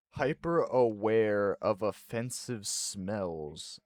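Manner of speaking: slow, deliberate reading from the screen; measured reading aloud of a result. Real, not acted. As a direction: read each word out slowly and distinctly. Reads test result aloud.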